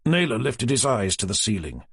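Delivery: plain reading voice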